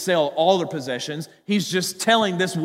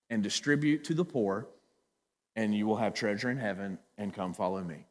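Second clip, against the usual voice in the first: gentle Jesus; 5 semitones lower, 4 dB quieter than usual. Retold or imitated. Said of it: "he said to him".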